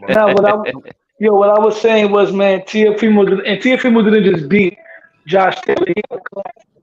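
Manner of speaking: choppy cutting-out mic audio; breaking up space radio. Real, not acted. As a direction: voice dropping out like a bad connection. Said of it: "You're breaking up".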